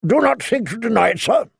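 put-on an old knight's sharp accusation. A narrator's reading. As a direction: cut him off sharply. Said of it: "Sir Barristan said sharply".

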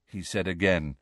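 usual speaking voice

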